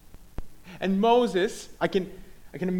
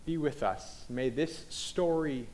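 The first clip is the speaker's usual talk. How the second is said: prayer; 2 semitones lower, 3 dB quieter than usual. Real, not acted. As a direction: pray unhurriedly, easing down towards the amen. Leads a prayer.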